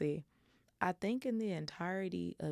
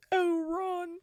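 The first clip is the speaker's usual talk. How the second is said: much higher and louder, breaking into tears on "Oh, Ron"; tearful; 6 dB louder than usual. Shown as a demonstration. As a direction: cry out "Oh, Ron" as she breaks down. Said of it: "It was one of them".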